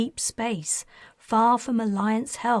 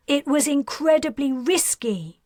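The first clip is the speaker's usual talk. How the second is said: higher and louder, an alarmed mother; exclaiming; 6 semitones higher, 4 dB louder than usual. A narrator's reading. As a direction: burst out in worried reproach. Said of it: "Talon exclaimed".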